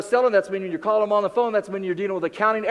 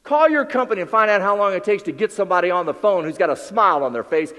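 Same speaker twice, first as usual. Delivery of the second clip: usual speaking voice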